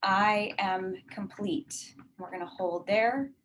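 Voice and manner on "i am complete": drawn-out, slow repeat of the affirmation; a slower, deliberate second take. Shown as a demonstration. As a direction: let each word settle, slowly. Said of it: "repeats affirmation, slower take".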